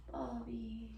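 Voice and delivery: very soft, drawn-out name; tender hush. Acted. Girlfriend addressing her late Bubby, tender and hushed. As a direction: breathe the name out softly.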